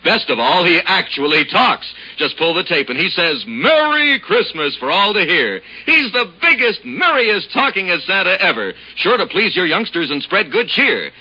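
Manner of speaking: normal talking voice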